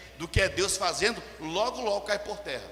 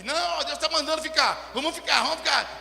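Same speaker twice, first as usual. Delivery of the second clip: higher-pitched insistent plea, lightly acted; mock zealous urging; 8 semitones higher, 3 dB louder than usual. Preacher mimics zealot wanting to stay late, insistent urging. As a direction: urge everyone on with mock zeal. Acts out an over-zealous churchgoer.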